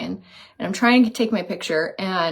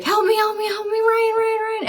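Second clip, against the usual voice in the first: retold panic, pleading high for Ryan; mock-frantic calls, voice pitched way up; panicked cry for help; 12 semitones higher than usual. Stung by a bee, begging Ryan for help in high panicked cries. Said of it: "I am screaming".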